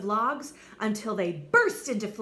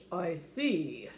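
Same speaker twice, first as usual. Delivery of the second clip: low, solemn doctor; serious doctor, gravely; 4 semitones lower than usual. Acted. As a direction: deliver bad news gravely. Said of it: "said the doctor gravely".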